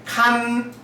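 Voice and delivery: 칸 held high and level; first tone. Shown as a demonstration. Teacher reading kan in a high level first tone. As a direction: say 칸 high and flat like a Mandarin first tone. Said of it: "K도 영어랑 K랑 음가가 똑같습니다".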